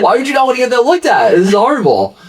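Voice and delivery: high, fast, horrified quote of the doctor; mock alarmed doctor. Doctor horrified by the wound, high and fast. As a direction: ham up the doctor's horror, high and quick. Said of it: "He's like".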